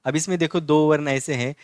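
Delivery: ordinary delivery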